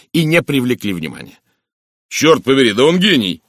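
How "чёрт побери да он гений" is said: loud, higher burst of admiration; approving exclamation. A narrator's reading. Inspector admiring a clever criminal, exclaiming. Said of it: "одобрительно воскликнул Инглс".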